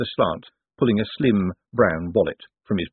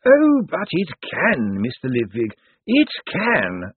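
put-on slow, higher, drawn-out relish from the old lawyer; lawyerly glee; 6 semitones higher, slower than usual. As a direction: savour each word like a triumphant lawyer. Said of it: "with lawyerly glee".

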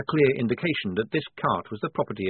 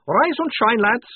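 high, loud, cheery vampire; vampire reassuring the soldier, high and cheerful; 13 semitones higher, 7 dB louder, slower than usual. A narrator's reading. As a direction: chirp it up high like a friendly vampire. Vampire waking imps.

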